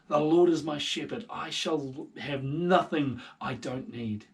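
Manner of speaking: loud declaiming psalm; David's psalm read out with conviction; psalm recitation. Acted. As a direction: proclaim it with trust. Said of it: "David's journal entries of him saying".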